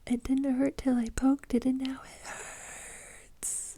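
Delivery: mock a hurt little girl's whine; imitating whiny daughter. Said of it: "And she was like".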